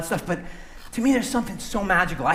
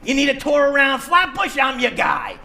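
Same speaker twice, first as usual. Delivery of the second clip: loud, higher, brash Brooklyn tour-guide voice; Mister Joe Brooklyn; 6 semitones higher, 5 dB louder than usual. Acted. Puppeteer voicing a character for the audience, loud Brooklyn guy. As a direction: bark it like a pushy New York hustler. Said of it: "Mister Joe Brooklyn".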